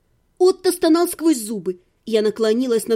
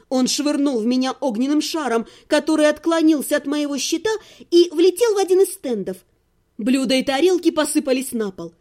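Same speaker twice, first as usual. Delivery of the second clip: ordinary delivery, nothing marked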